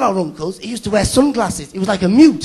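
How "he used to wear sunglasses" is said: usual speaking voice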